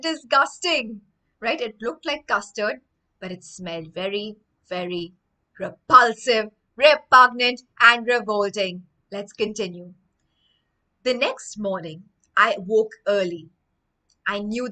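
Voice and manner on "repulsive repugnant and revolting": each word punched loud; emphatic vocab list. Shown as a demonstration. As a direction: hit every word hard for the children. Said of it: "teacher stressing vocabulary words".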